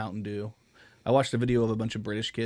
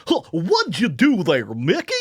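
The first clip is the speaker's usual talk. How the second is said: higher, louder, slow dopey cartoon voice; Goofy voice; 7 semitones higher, 11 dB louder, slower than usual. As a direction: drag it out like a goofy cartoon dog. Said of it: "I do Goofy".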